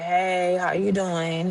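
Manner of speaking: faint, tired hello from the couch; weak voice. Retold or imitated. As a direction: voice a frail, sick dad saying hello. Sick father greets her weakly.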